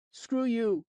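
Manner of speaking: put-on a mouthy boy sneering at a grown-up; bratty kid. Rude kid insulting narrator.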